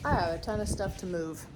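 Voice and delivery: muffled behind a mask; talking through a face mask. Real, not acted. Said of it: "I don't know if you can hear me through the mask".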